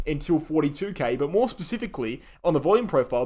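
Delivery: ordinary talk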